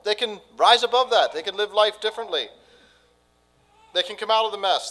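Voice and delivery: loud, high, fervent preaching; preaching at full force. Acted. Pastor urging the congregation toward hope, preaching full force. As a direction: lift the whole room with the good news.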